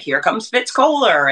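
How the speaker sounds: lightly hammed-up stadium announcer call; race announcer. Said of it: "big voice".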